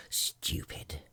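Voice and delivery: breathy scornful aside; whispered insult. A narrator's reading. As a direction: hiss it under your breath. Hatter's muttered insult.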